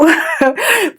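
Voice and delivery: short high disbelieving laugh; incredulous giggle. Real, not acted. Laugh after rude quote.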